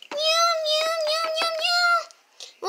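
very high kitten meows; cat meowing. Acted. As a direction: meow like a soaked cat.

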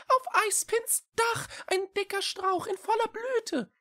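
put-on high, eager young creature voice; small cat voice. A narrator's reading. Echo telling the witch he knows, eager little cat. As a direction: speak up keenly, like a tiny cat.

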